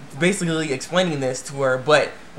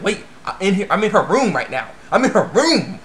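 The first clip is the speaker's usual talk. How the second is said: excited mock disbelief, louder on the repeat; acted shock; 3 semitones higher, 3 dB louder than usual. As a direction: marvel at it as if stunned. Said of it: "he's all shocked".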